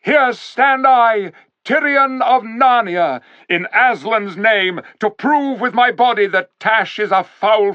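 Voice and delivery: great ringing cry. A narrator's reading. King rallying the Narnians, loud heroic cry. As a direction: call the whole crowd to battle. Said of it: "cried in a great voice".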